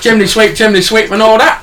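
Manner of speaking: hammed-up Cockney chimney sweep; Cockney accent. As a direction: put on a silly, high Cockney voice. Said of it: "Can you do Cockney?".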